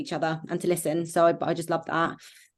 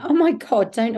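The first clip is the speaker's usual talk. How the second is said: louder and higher, gushing; high-pitched sympathy; 5 semitones higher, 7 dB louder, slower than usual. Real, not acted. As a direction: burst out in sympathy. Comforting a crying friend.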